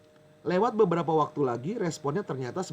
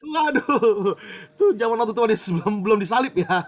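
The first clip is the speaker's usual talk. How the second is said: laughing reaction; 5 semitones higher, 7 dB louder than usual. Real, not acted. Joking reaction to audience.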